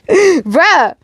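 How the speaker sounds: laughing, high-pitched exclamation; real amused disbelief; laughing bruh. Real, not acted. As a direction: burst out with it mid-laugh. Reader reacts laughing to scene.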